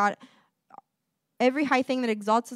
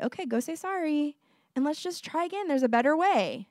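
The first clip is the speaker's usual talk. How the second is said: encouraging parent; 5 semitones higher than usual. Retold or imitated. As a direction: gently nudge a child to try again. Voices parent encouraging child.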